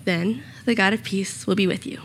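ordinary talk

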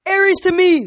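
high, loud rallying scream; rally the troops with a scream; screamed battle call. A narrator's reading. Clarice calling her cabin to her, screaming loud and high. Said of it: "Clarice screamed".

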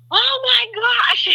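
very high, drawn-out squeal of amazement; slow, high-pitched astonished exclamation; amazed excitement. Retold or imitated. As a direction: stretch it out high, reliving the surprise. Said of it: "it's still exciting and amazing".

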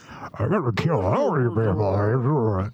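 slow menacing movie villain. Retold or imitated. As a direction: drawl it out like a brute.

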